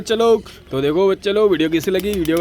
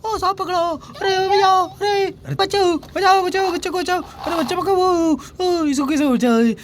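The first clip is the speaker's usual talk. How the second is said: put-on much higher, panicked child yelling for help; crying child's voice; 11 semitones higher than usual. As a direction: squeal for rescue like a frightened kid caught by a snake. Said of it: "अरे बच्चा तो रो रहा".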